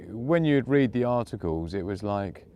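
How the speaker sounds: normal talking voice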